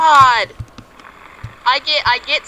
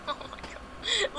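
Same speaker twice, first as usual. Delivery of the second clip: relieved laughter; 12 semitones higher, 16 dB quieter, slower than usual. Real, not acted. Laughs after making it.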